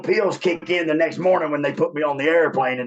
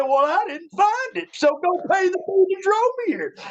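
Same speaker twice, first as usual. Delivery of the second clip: much higher, smug comeback; cocky retort. Retold or imitated. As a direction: toss it off, defiant and amused. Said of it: "And I said".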